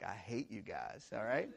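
low, soft mock resentment; understated, half-hearted hurt; disbelieving grumble. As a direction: grumble it quietly at teasing friends. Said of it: "he thinks that they're, like, totally kidding".

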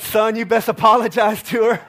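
a whole line of people telling him off; mock crowd scolding. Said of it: "They said".

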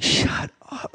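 hushed, breathless "shut up"; whispered retort. Retold or imitated. Teasing back at friend Jason, whispered retort. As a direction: hiss it playfully at your friend.

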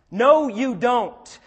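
slow, loud, flat denial; firm rebuke. Acted. Preacher refusing the excuse for divorce, slow and loud. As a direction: stop them cold.

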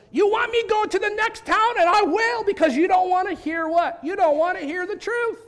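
high, loud defiant preaching; preacher yell. Retold or imitated. Martin Luther defying his church, loud defiant shout. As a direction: shout it at the stubborn town. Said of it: "he goes".

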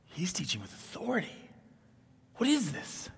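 hushed, astonished disbelief; soft, fearful amazement jumping high on the question; shocked awe. Acted. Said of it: "they were shocked that he was doing this".

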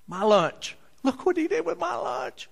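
put-on high, amazed little boy. Little Irving marvelling at his lunch, high child voice. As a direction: sound like a delighted ten-year-old.

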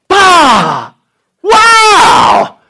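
big, loud burst of amazement; amazed outburst. Acted. Bitten man looks and is healed, amazed shout. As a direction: exclaim wildly, high and loud. Acts out sudden healing on looking.

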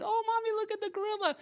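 put-on high-pitched, quick child's voice; little kid. Little kid pointing out the gorilla to mommy, high excited child voice. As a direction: squeal it like an excited small child. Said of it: "there's a little kid".

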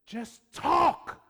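loud high burst; exclaiming. Real, not acted. Preacher exhorting.

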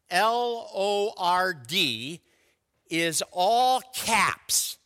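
"L O R D" spelled letter by letter, slow and emphatic; spelled out slowly. Shown as a demonstration. Preacher spelling out the word for the congregation, slow and deliberate. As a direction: spell each letter slowly, then lean on "all caps". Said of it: "the word Lord".

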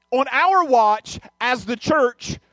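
very slow, heavy on each word; slow pointed quote. Retold or imitated. Speaker challenging the church, slow and pointed. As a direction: lay the charge on the church. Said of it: "And she says".

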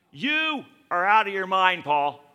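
loud, high outburst at Paul; shouted accusation. Acted. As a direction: bellow it like an angry governor. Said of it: "he shouted".

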